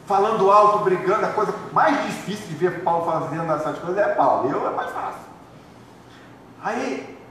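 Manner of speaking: usual speaking voice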